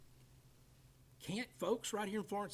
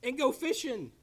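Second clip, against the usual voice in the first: voicing Jesus; 9 semitones higher, 4 dB louder than usual. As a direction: coax the tired fishermen back out to sea. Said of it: "and he says".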